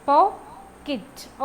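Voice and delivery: "പോക്കറ്റ്" slowly in two syllables; syllable demo. Shown as a demonstration. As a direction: split "പോക്കറ്റ്" into poc-ket, slow and loud. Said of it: "okay, അങ്ങനെ".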